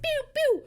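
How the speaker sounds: high, loud "pew, pew" zapping; pew-pew noises. Retold or imitated. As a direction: imitate zooming cats with high pews. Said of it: "They're both just like".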